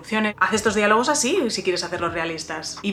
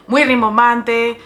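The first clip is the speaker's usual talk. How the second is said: louder, hammed-up grandiose emphasis; mock pompous; 2 semitones higher, 8 dB louder than usual. Writer mocking her beginner style, mock pompous. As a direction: puff up each word with mock grandeur.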